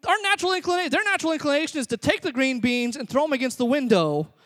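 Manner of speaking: high, loud, incredulous exclaiming; full-force comic preaching. Real, not acted. Preacher telling parents what toddlers really do with food, loud incredulity. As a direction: belt out the absurd truth to the crowd.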